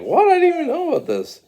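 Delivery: high, loud, fast disbelief; mock shocked outburst. Mario to Luigi on learning his secret, high shocked outburst. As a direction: burst out in rapid cartoon shock.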